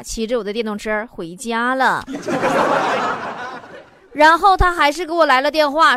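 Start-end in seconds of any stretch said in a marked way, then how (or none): 2.1-3.8 airy laugh at the punchline; breathy laugh. Real, not acted. Radio host cracking up at a listener's joke, airy laugh. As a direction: laugh it off, unvoiced.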